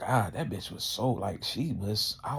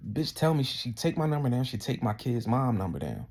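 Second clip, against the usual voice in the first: ordinary talk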